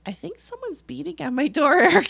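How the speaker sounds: loud, high alarmed report with a laugh. Retold or imitated. Telling Eric about the knocking, loud alarmed laugh. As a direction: blurt it out half laughing. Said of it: "And I'm like".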